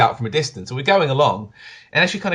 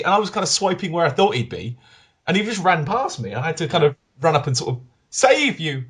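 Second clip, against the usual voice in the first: usual speaking voice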